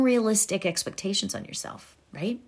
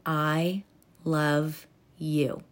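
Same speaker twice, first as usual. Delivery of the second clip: soft, lower and very slow; tender affirmation; 5 semitones lower, 6 dB quieter, much slower than usual. Shown as a demonstration. Coach demonstrating a tender mirror affirmation. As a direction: say it gently to your own reflection.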